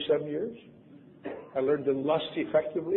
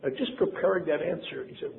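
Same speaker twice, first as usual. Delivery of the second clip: normal talking voice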